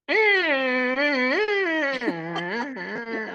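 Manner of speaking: long high imitated door creak. A sick boy imitating his door creaking open like a horror movie. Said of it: "Like this, you know".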